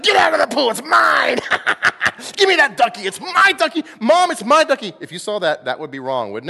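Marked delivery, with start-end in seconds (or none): 0.0-4.9 very high, loud whiny tantrum; bratty child. Pretend. Pastor acting a grown man kicking kids out of the pool, whiny tantrum. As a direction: shriek like a toddler fighting over a toy.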